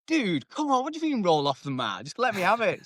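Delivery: high-pitched retold pleading; exasperated protest.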